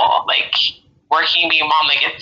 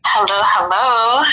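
nervous; 5 semitones higher, slower than usual. Real, not acted. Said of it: "I'm nervous".

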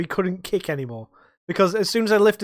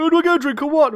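mocking whiny customer, much higher and louder; impatient customer; 12 semitones higher, 7 dB louder, faster than usual. Bartender mimicking a demanding drunk at the bar, high mocking whine. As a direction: send up a pushy drinker demanding service.

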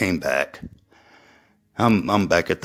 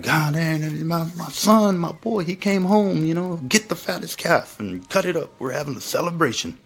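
put-on much higher, excited old dad voice; a father overjoyed his son came home; joyful father; 11 semitones higher than usual. Father calling for a feast for his son, high excited voice. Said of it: "His dad's like".

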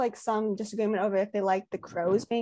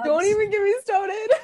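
laughing cry; 9 semitones higher, 4 dB louder than usual. Real, not acted. Fangirl crying over ship.

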